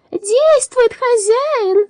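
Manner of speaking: high, loud metallic cry; shouting robot voice. A narrator's reading. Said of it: "закричал он".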